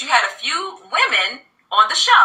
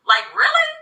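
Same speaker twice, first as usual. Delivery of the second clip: incredulous; 10 semitones higher, slower than usual. Retold or imitated. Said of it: "I'm just looking at her like".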